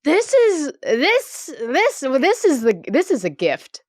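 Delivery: high, delighted stammer; can barely get it out, thrilled; excited disbelief. Real, not acted. Cohost realizing the two versions differ, thrilled.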